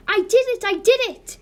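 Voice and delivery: very high, loud triumphant shout; delighted scream. Acted. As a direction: shriek it with sheer delight. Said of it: "Elizabeth screamed delight".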